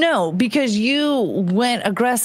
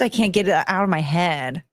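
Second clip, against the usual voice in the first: ordinary talk, nothing marked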